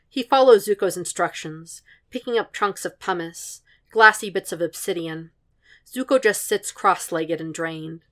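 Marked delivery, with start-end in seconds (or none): none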